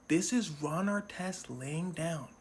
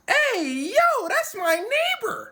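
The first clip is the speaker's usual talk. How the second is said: high, loud, slow realization; surprised recognition; 8 dB louder, slower than usual. Retold or imitated. Prosecutor spotting his neighbor on tape, high surprised exclaim. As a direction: exclaim like spotting someone you know.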